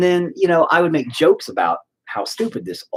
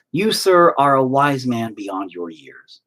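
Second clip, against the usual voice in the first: ordinary delivery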